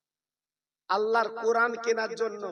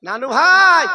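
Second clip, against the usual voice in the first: loud, raised call to a grandchild; 5 semitones higher, 15 dB louder, slower than usual. Acted. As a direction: call out, stretching the last word. Calling out to the sleeping grandchildren. (জোরে ডাক দেওয়া)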